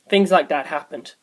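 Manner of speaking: ordinary delivery, nothing marked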